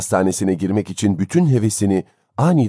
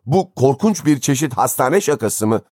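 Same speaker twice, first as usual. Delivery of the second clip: higher-pitched indignant question; outraged; 6 semitones higher than usual. A narrator's reading. Father accuses the hospital of joking, outraged. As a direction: demand an answer in horrified anger. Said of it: "Dehşeti öfkeye dönüşen".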